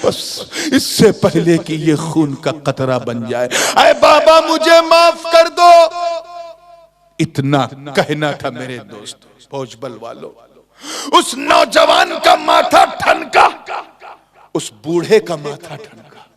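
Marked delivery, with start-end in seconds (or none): 3.8-5.6 very high, loud plea; begging forgiveness. Acted. Youth begs forgiveness, acted. 11.2-13.5 loud, slow, ringing announcement; dramatic exclamation. A narrator's reading. Loud take, then subdued counterpart.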